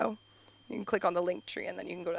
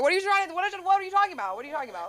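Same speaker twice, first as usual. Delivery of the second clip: flustered dad; 10 semitones higher, 3 dB louder, much faster than usual. Retold or imitated. Imitating dad's flustered reply.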